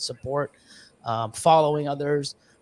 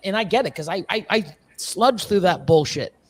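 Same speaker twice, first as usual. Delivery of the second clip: ordinary talk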